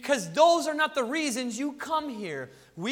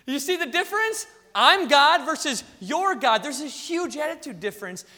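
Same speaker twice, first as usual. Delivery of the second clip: ordinary delivery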